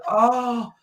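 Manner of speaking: high startled "Oh!" as the defenders get beaten; mock surprised defenders. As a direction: yelp it, a defender caught flat-footed. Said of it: "defenders just like".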